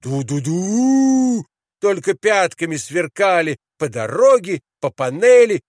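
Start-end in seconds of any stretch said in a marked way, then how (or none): 0.0-1.4 high, long hoot; shouted ship horn. A narrator's reading. Boys racing on as steamships, high hooting. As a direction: toot high, swelling on the last note. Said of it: "и кричали".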